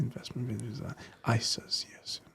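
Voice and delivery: unvoiced mock-serious whisper. Real, not acted. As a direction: whisper it like a secret. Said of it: "we're all whispering".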